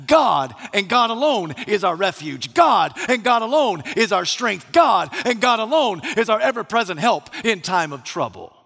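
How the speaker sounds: "God" punched high at each start; emphatic preaching. Real, not acted. Preacher declares God alone is refuge, emphatic stress on "God". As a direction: hit "God" hard every time, building by repetition. Preacher hammering the psalm's first word.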